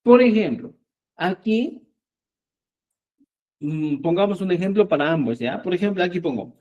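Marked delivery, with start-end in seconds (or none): none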